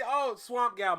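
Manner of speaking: normal talking voice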